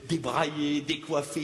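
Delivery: ordinary talk, nothing marked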